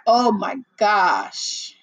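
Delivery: usual speaking voice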